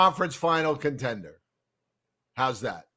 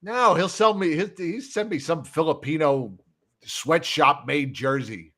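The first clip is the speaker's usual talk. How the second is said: usual speaking voice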